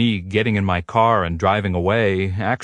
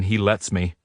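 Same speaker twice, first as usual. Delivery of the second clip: ordinary delivery, nothing marked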